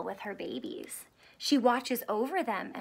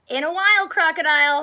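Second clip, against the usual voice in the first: character line; 5 semitones higher, 9 dB louder, faster than usual. A narrator's reading.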